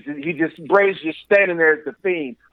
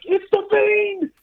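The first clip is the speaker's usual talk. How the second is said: high, stretched-out mock alarm; overdramatic commentator; 14 semitones higher, much slower than usual. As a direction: announce the monster's arrival, hammed up. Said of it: "overdramatic, which was the point".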